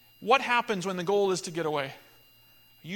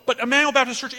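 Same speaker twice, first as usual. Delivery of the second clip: "Emmanuel Baptist Church" called out much louder and higher; loud preaching; 6 semitones higher, 9 dB louder, faster than usual. Real, not acted. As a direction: proclaim "Emmanuel Baptist Church" like a rallying cry. Preacher's climax.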